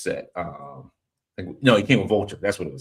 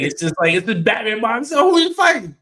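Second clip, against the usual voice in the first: rising excitement; 14 semitones higher, 9 dB louder than usual. Real, not acted. Mocking lone Batman, rising excitedly.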